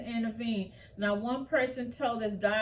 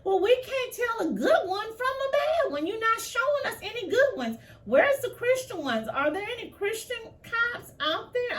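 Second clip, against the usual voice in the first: much higher, heated, near-shouting plea; emotional outburst; 13 semitones higher, 3 dB louder than usual. Real, not acted. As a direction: pour out the frustration, pitched way up. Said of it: "I get so emotional about these things".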